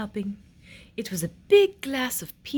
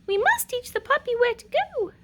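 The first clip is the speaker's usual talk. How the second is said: high, patient queen voice; 12 semitones higher than usual. Acted. Queen telling the prince, high and patient. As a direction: explain it gently like a mother. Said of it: "the queen said to the prince".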